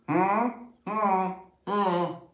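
high, closed-mouth nagging hum; whining child. Acted. Kids still pestering an ignoring parent, whining. Child's calls turn to whine.

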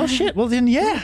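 loud high surprised outburst. Real, not acted. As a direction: blurt it out in shock. Sudden realisation, loud.

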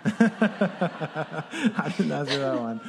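real laughter running into the words; amused, breaking up while talking; laughing. Real, not acted. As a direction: tease the guest through a laugh. Laughs at guest volunteering her age.

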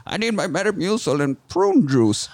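put-on high, crotchety old man voice; grumpy old man. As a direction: play a cranky old geezer. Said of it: "I'm Old Man".

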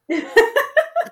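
bright high laugh, loud and short; high burst of laughter. Real, not acted. Host laughing at guest's self-deprecating answer. Reacts to unfortunately.

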